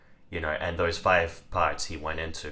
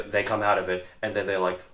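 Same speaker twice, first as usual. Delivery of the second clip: ordinary delivery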